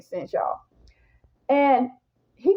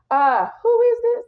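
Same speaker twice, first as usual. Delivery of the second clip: high, louder call through the door; 13 semitones higher, 4 dB louder than usual. Retold or imitated. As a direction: call out to the stranger outside.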